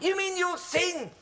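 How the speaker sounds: high, drawn-out thrilled question; delighted disbelief. Acted. Host hears the guest will sing, delighted disbelief.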